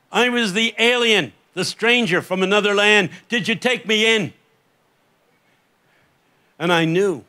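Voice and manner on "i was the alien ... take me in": raised, higher-pitched solemn challenge; impassioned voice from afar. Acted. Voice down the corridors of time questions the preacher, ringing challenge. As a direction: build to a ringing final question. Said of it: "that voice echoing down the corridors of time".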